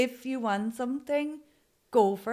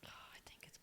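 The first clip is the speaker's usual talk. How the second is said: whisper; 29 dB quieter, much faster than usual. Real, not acted. Hushed aside before answering.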